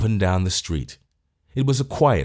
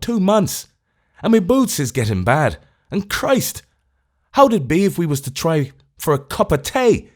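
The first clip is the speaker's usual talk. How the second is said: high, loud, self-pitying Irish whine; whimpering Irish voice; 7 semitones higher, 3 dB louder than usual. A narrator's reading. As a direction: whine on about your lot like an Irish tramp. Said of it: "in a whimpering, self-pitying Irish voice".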